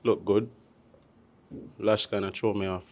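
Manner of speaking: ordinary talk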